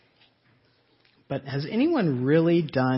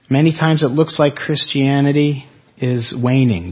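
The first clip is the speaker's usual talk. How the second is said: louder, quicker push on the point; louder preaching; 10 dB louder than usual. Real, not acted. Pastor urging the congregation while the map plays, louder. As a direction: drive the point home over the video.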